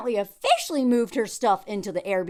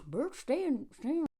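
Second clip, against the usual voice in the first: softer mean little voice; put-on voice for a dog; Sam voice; 2 semitones higher, 7 dB quieter than usual. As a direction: grumble it quietly as an imagined character. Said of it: "This Sam voice I'm talking about".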